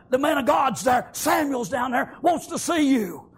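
loud, high, insistent urging; urgent call. Acted. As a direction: press him to hurry home. Servant urges David to come.

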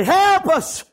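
high, loud cries for help; preacher's acted desperate wail; agonized screaming. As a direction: holler it like the drowning begging to be let in. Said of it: "screaming and agony".

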